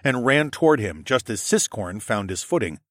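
ordinary delivery, nothing marked

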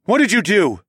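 high, loud accusation; angry demand. A narrator's reading. Narrator confronting wolfmen over dying friend, angry demand. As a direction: shout the accusing question.

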